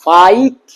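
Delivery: ফাইট as long 'faa-it', rising; drawn-out rising ফাইট; joined vowels. Shown as a demonstration. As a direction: glide slowly from 'a' to 'i' in ফাইট. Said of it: "দুটো একসঙ্গে হয়ে গেছে".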